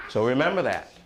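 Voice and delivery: usual speaking voice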